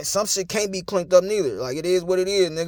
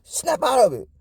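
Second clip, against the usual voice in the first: higher-pitched urgent imitation; mock movie command; 5 semitones higher than usual. Movie man to hysterical woman, mock urgent command. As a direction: act out snapping someone to their senses. Acting out old movie slap scene.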